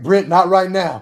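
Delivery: high, loud imitated snap; mock snapping. Friend mimicking his brush-off at the airport, loud and high. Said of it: "You're like".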